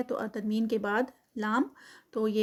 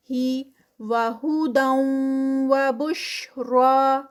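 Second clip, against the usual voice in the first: slow, drawn-out tajweed recitation; Quranic recitation; 2 semitones higher, 6 dB louder, much slower than usual. Shown as a demonstration. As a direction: chant it slowly, stretching each sound. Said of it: "دال کے اوپر تنوین". (آہستہ کھینچ کر تلاوت)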